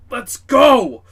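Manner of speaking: loud, high holler to the crew; rallying shout. Acted. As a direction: yell it out to get everyone moving. Jozu rallying the shaken crew.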